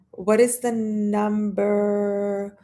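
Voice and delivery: puzzled slow echo of "What is the number?"; drawn-out puzzled repeat. Acted. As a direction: mull the question over aloud.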